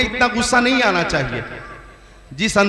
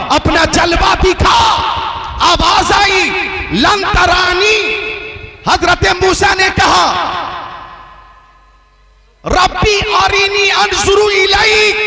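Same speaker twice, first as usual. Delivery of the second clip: preaching at full force; 7 semitones higher than usual. Acted. Preacher thunders the Moses story to the crowd, full-force preaching.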